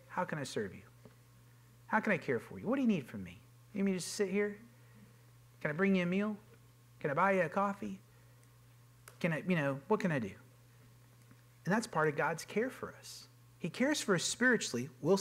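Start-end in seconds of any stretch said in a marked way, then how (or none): 0.1-10.3 gentle caring offer. Retold or imitated. Offering care to someone who is down.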